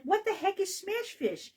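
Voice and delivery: high-pitched mock bafflement; baffled reaction. Host retelling first hearing of smash fish, baffled.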